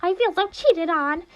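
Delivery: high, pouty cartoon bear ranting about his crush; high whiny bear voice, hurt and angry; Monokuma's high voice.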